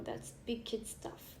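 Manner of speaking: ordinary talk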